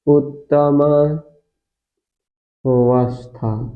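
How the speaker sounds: उत्तमावस्था stretched out very slowly; drawn-out term. Shown as a demonstration. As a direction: drag out each syllable of the new term. Teacher stretches out the key term.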